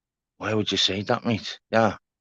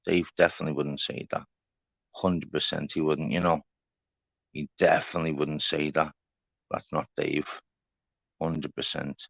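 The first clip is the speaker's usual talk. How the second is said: quiet muttering; 11 semitones lower, 10 dB quieter than usual. Real, not acted. Muttering to himself about Dave.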